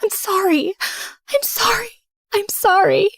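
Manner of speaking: high, pleading repeated apology; tearful pleading. Acted. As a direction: beg forgiveness, voice lifted and strained.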